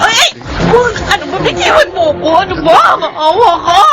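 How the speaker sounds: high crying plea for mercy; begging the attacker to let her go; desperate pleading. Acted. Wife resisting an attack. (umiiyak na nagmamakaawa)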